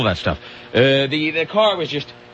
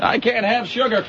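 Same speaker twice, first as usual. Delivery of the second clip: put-on grumpy old man; 9 semitones higher than usual. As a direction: whine it like a cranky old man. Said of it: "what caption goes with that picture".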